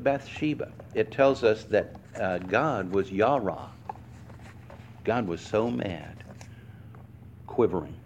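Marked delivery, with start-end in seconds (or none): none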